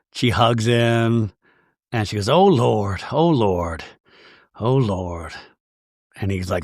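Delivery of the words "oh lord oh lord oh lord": drawn-out imitation, sinking in pitch; lightly put-on churchgoing woman sighing out each repeat; mock "oh Lord". Voicing Mary hugging cousin.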